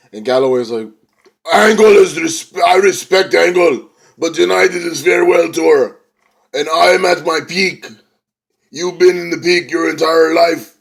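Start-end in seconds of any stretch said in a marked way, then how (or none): 1.5-10.8 loud, high Scottish wrestler promo; badly faked Scottish accent, declaiming; attempted Scottish accent. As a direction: put on a rough Scottish accent for a big challenge. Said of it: "I can't do the Scotland accent".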